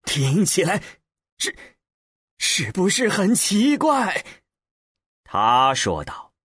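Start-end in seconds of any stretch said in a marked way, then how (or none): 0.0-4.2 higher-pitched wondering question; a touch of delighted astonishment; amazed, with a hint of pleasure; pleasantly surprised. A narrator's reading. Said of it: "他显然还带着一分惊喜".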